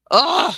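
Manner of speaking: loud, high exasperated "ugh"; frustrated groan. Real, not acted. Teacher annoyed at her listings, loud frustrated groan.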